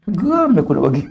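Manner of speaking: high laughing aside, half amused; amused chuckle at the question. Real, not acted. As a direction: laugh it off in mock annoyance.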